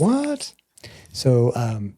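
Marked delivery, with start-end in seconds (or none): 0.0-0.5 surprised what. Retold or imitated. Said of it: "it's like".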